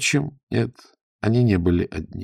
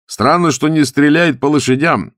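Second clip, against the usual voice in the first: high, raised riding shout; shouted remark; 8 semitones higher, 5 dB louder than usual. A narrator's reading. Zagorsky wonders at the pursuers' tactics, shouting. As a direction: call it out loud to your companions. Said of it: "крикнул Загорский".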